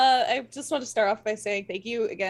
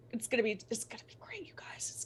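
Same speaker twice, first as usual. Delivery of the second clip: breathless whisper of excitement; whispered gushing to the chat; excited whisper; 12 dB quieter, faster than usual. Real, not acted. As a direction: hush it like a thrilling secret. Excited aside to the audience.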